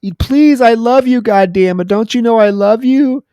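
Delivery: high, loud frightened begging; mock panicked pleading. Wife begs her attacker husband, high and panicked. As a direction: plead in terror to stop him. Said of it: "she's screaming".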